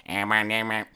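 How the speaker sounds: flat robotic toy speech. Retold or imitated. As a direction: speak and spell robot voice. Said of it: "in a robotic voice".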